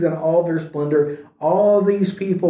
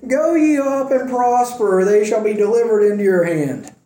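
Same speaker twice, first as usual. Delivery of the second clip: dripping sarcasm; 6 semitones higher than usual. Retold or imitated. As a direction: lay the sarcasm on thick, pitched way up. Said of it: "this is absolutely dripping with sarcasm".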